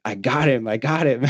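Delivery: retold excitement. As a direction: replay excitedly telling your buddy the news. Retold announcing the kill to buddy.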